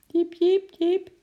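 impatient squirrel chirp. A narrator's reading. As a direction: peep insistently like a restless little squirrel. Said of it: "piepte Ekie ongeduldig".

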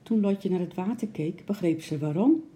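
ordinary delivery, nothing marked

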